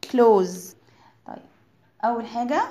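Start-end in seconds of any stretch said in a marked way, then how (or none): 0.0-0.8 "close" with a voiced z ending, said slowly alone; model pronunciation. Shown as a demonstration. Teacher showing kids how clothes sounds, "close" modelled clearly. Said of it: "نفس النطق بتاع".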